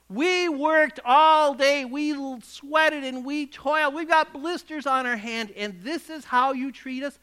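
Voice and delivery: angry complaint. Acted. Angry workers complaining in parable.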